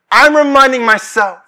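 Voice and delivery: high, loud declaration; emphatic pulpit voice; impassioned preaching. Real, not acted. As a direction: proclaim it with conviction. Preaching at full force.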